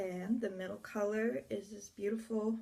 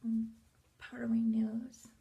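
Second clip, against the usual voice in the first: whisper. Real, not acted. Whispered aside while applying.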